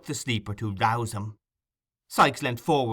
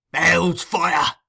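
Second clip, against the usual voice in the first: loud, raised oath; fierce outburst; 5 semitones higher, 7 dB louder than usual. A narrator's reading. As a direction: explode with rage.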